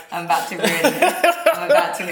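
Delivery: giggling through the words; laughing. Real, not acted. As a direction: laugh while confessing it. Admits the urge to tease him.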